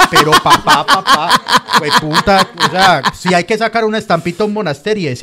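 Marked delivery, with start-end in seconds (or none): 0.0-2.5 real laughter bursting through the words, higher and louder; laughing. Real, not acted. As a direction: laugh out the words, barely holding it together. Cracking up over the friend. (riendo a carcajadas)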